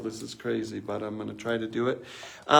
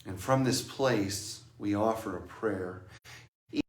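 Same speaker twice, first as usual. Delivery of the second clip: slow, hushed contemplative voice; meditative reflection; 3 semitones lower, 4 dB quieter, slower than usual. Acted. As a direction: let each phrase settle softly.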